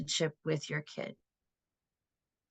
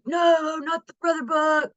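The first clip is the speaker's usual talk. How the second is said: high, loud mock kids' protest; hammed-up childish groan of protest; whining kids; 8 semitones higher, 4 dB louder than usual. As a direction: whine like boys dragged to a chore. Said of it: "And they'd be like".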